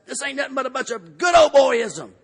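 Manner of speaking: retold angry shout. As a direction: shout it like a furious man at a meeting. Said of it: "and the guy's saying".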